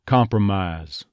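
"compromise" ending in a long ah-z; flattened I "compromise". Shown as a demonstration. Coach shows the Texas diphthong, "compromise" ending mahz. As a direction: drop the glide in "-ise" of "compromise". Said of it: "dropping the second half".

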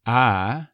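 "I" said as "ah"; halved diphthong. Shown as a demonstration. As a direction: flatten "I" to a long "ah", no glide. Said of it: "dropping the second half of the I diphthong".

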